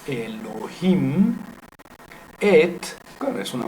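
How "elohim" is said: "Elohim" drawn out slowly, rising on "him"; stress on last syllable. Shown as a demonstration. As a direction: land long and firm on the "him" of "Elohim". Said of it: "marcar dónde se pone el acento".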